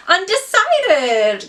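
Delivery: high, slow, drawn-out and self-mocking; a drawn-out, self-mocking reply to people asking her major; sheepish mock answer. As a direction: stretch it out like an embarrassed student. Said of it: "sounding really dumb".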